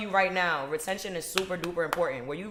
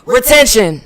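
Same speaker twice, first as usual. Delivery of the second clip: loud proclaimed Retention; louder emphatic Retention. for the room; shouted keyword; 4 semitones higher, 17 dB louder, slower than usual. Real, not acted. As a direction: belt out Retention. like a slogan. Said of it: "Say it one more time".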